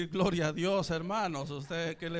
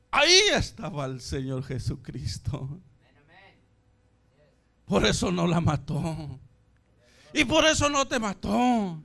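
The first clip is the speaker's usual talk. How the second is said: voice of God; 9 dB louder than usual. Retold or imitated. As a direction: proclaim it as a heavenly voice, ever more forceful. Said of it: "una voz me dice".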